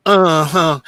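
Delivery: normal talking voice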